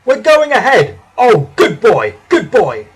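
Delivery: loud, elated cheering, starting high; excited shout. A narrator's reading. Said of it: "he shouted".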